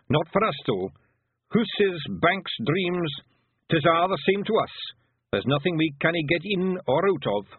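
cocky little Scottish warrior; boasting Feegle. Acted. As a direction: brag as a wee Scots fighter.

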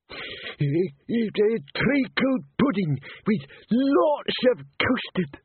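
high, slow mumble of a man dreaming; sleep-talking. A narrator's reading. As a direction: talk in your sleep in a high, dragged-out voice. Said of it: "He talks in his sleep".

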